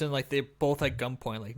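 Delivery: ordinary talk, nothing marked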